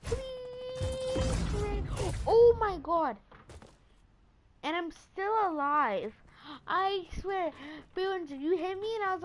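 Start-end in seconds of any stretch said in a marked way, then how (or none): 0.0-3.2 high, thrilled exclaiming; excited. Real, not acted. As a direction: cheer it out. Celebrating success.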